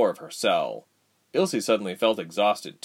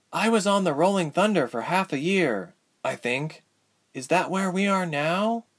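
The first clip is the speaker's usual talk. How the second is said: high, questioning character voice; puzzled heroine; 9 semitones higher than usual. Acted. Ilse to Tyke about the ship, high puzzled voice. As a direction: work it out in a high voice.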